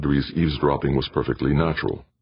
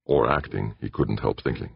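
ordinary delivery, nothing marked

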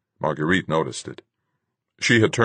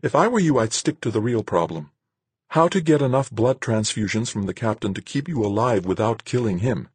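higher, brisk-minded woman's voice; firm woman character; 7 semitones higher than usual. Acted. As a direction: set him straight in a higher voice. Marguerite lectures Van.

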